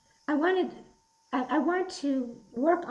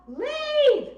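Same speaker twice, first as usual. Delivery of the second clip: high, loud shouted leave; yell; 13 semitones higher, 5 dB louder than usual. Shown as a demonstration. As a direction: order them to go away. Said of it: "Everybody yell".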